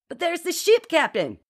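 high, pleading crewman's whine; whining. A narrator's reading. Percival protests to the captain about ship, whining. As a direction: whine upward at your superior. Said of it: "Percival whined".

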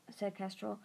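plain reading voice

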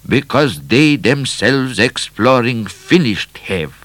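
put-on slow, high, accented pronouncement; foreign explorer. A narrator's reading. Explorer explaining the deaths, slow accented voice. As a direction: spell it out slowly in a foreign accent.

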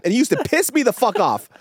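ordinary delivery

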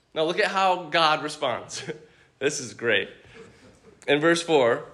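ordinary delivery

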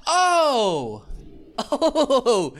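high laughing "oh", drawn out and falling; mock gloating laugh. Gloating when she asks for help.

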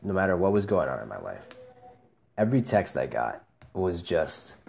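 low, soft, subdued recollection. Real, not acted. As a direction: let the voice sink low and quiet. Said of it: "why are you making your voice deeper?".

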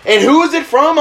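acting out a rattled father; nervous and scared. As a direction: demand an answer in mock alarm. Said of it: "so fucking nervous and scared".

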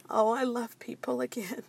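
hushed, whispery retold relief; touched, relieved murmur. Mother moved by a kind dad at customs, hushed. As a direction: say it softly, almost whispered.

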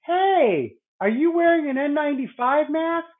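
high-pitched startled question; mock the wife's shocked challenge; imitating wife's disbelief. Wife confronts friend about mask.

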